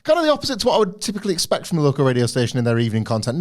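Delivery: high-pitched teasing jab. Real, not acted. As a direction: rush it out high and cheeky. Teasing, high then fast.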